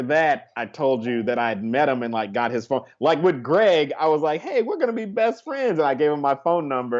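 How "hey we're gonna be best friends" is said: high-pitched, over-friendly pitch; mock overeager new best friend, voice way up; eager greeting. Comic greeting a stranger at an audition, mock eager.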